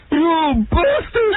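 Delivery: high, slow, strained mock yell of a red-faced dad; imitating an enraged dad, half laughing; furious father. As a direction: bellow it like a father losing his temper. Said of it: "my father just turned beet red and he goes".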